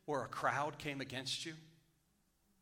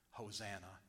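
hushed Hosanna; 6 semitones lower, 11 dB quieter than usual. Acted. Quoted cry in hushed sermon.

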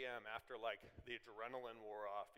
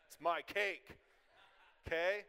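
higher, louder and slow, laughing it out; playful insistence; 4 semitones higher, 8 dB louder than usual. Real, not acted. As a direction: claim it with a laugh, slow and loud. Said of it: "I don't let the enemy take any of my cake".

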